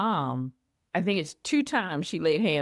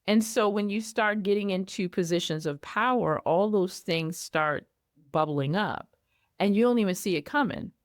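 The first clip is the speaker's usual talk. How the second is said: ordinary talk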